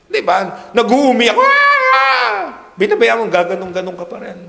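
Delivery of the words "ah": a sudden very high crying wail imitating a child; imitated kid's cry, high and loud; mock child wail. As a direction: shriek like a bawling toddler.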